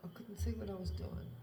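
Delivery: mock-innocent mum pleading blindness; mum's excuse. Mum explaining the rubbish in the car, high feeble excuse. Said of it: "I said".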